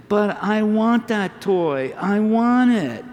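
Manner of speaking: put-on high, slow whiny child wanting a toy; whining toddler. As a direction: whine it like a two-year-old begging. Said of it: "he was whining".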